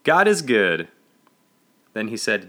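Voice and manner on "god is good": loud, higher answering call; shouted response. A narrator's reading. Congregation finishing the pastor's call, shouted. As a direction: finish the chant with a hearty shout. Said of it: "the congregation finished".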